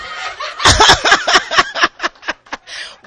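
high, loud peal of laughter. Real, not acted. Laughing about feeling bipolar.

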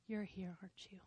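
whispered, awed and tender; hushed whisper. Retold or imitated. Speaking to the felt presence beside the bed, whispered. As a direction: breathe it into the dark room. Sensing God's presence at night.

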